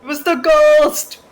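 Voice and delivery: high, loud protest; shouting excuse. Real, not acted. Player shouting back at the spider, high and loud. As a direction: yell it out, blaming the ghost.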